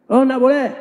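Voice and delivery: high, loud haughty no; arrogant refusal. Acted. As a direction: brush the beggar off from above. Rich man refuses the traveler. (menolak musafir dengan sombong, nada tinggi dan keras)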